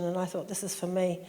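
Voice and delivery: normal talking voice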